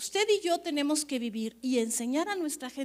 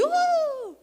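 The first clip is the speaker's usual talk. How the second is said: mock crowd whoop; 14 semitones higher, 5 dB louder than usual. Mimics congregation cheering.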